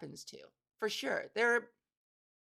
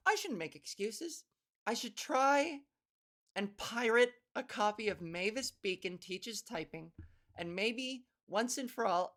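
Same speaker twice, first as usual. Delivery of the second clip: ordinary talk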